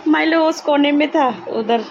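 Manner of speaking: high, loud, laughing exclamation; amused surprise. Real, not acted. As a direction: exclaim it loudly, pitch jumping up. Startled on spotting Milo in corner.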